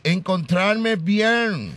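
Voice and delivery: very slow, high gringo-accented Spanish; mock English. Shown as a demonstration. Host showing Eva how to answer, exaggerated English accent. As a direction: drawl it out as fake American English. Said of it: "tienes que responder en inglés".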